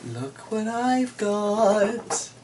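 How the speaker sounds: high, drawn-out creepy host voice; a hammed-up witchy welcome to the listeners; spooky storyteller. Spooky storyteller greeting the audience.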